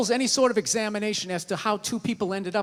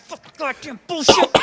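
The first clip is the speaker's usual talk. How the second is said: Tourette's outburst; 3 semitones higher, 5 dB louder than usual. Retold or imitated. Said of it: "I got, like, Tourette's".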